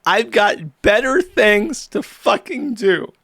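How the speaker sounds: drawn-out mock gamer indignation; quoting rage-quitter. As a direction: quote the rage-quit line slowly, without shouting. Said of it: "He rage quits the game and says".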